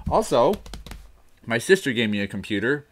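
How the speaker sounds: usual speaking voice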